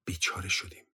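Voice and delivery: low, soft, fearful murmur; understated dread, softly voiced; trembling voice. A narrator's reading. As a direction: say it low, as if shaken. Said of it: "ستوان با صدای لرزان گفت".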